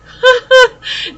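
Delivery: sudden high bright laugh; burst of laughter. Real, not acted. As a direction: break into a real laugh. Laughs aloud after naming release.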